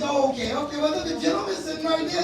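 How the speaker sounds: ordinary delivery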